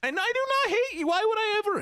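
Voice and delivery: very high, fast sweet voice; high falsetto. Pretend. Mock sweet reassurance to chat.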